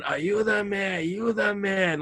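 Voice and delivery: high pleading cry for help in Spanish; mock-screaming for help in Spanish. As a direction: beg high like he's being beaten. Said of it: "the dude is just screaming".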